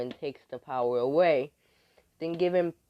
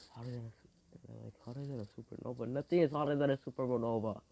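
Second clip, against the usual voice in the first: doubting objection; 7 dB quieter, faster than usual. Acted. Opponent doubting the fire boast, quiet quick scepticism. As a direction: question it softly and fast.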